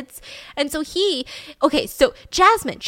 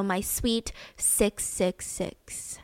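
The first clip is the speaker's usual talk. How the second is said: slow and much softer; creepy love note; 3 semitones lower, 8 dB quieter, much slower than usual. Retold or imitated. Girl signing her letter to Jeremy, in a slow soft eerie voice. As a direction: linger over the words, eerie.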